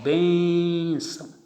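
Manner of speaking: Bênção said very slowly, weight on BÊN; teacher drawing out Bênção to mark its stress; stressed syllable shown. Shown as a demonstration. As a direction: stretch BÊN-ção to show the stress. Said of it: "Aqui está a tonicidade".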